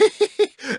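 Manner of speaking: short high soft chuckle; gloating laugh. Acted. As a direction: let out a pleased little laugh. Teacher gloats at student.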